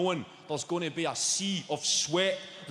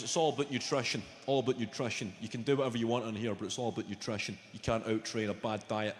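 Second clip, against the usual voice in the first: lower, faster mock gym trainer; gym guy voice; 8 semitones lower, 3 dB quieter than usual. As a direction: lecture him like a meathead trainer. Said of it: "The guy goes".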